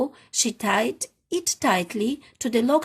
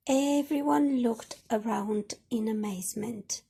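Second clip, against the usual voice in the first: ordinary delivery, nothing marked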